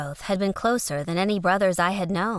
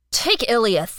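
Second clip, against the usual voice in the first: louder, sharp dismissal; loud snapping retort; 4 semitones higher, 6 dB louder than usual. A narrator's reading. Jeroth dismissing his brother, loud retort. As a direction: throw the name at him.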